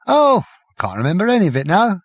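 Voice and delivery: high, careless, drifting; airy. Acted. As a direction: shrug it away. Prack vague and airy.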